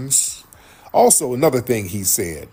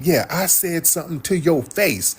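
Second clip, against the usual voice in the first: higher, imitated bragging; retold boast; 7 semitones higher than usual. Anton boasting he confronted Corey, retold brag. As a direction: act out a cocky boast, pitched up.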